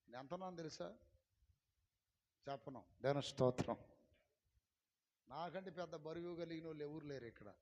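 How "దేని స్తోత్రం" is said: loud, low proclamation; loud praise call. Real, not acted. Preacher calling praise to the congregation, low and loud. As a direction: call it out to the crowd.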